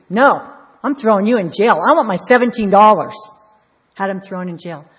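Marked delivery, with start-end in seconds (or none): 0.1-3.1 loud, harsh refusal; angry shout. Retold or imitated. Servant yells at his debtor before jailing him. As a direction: yell it with no mercy at all.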